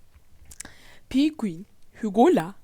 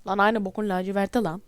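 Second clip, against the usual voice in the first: usual speaking voice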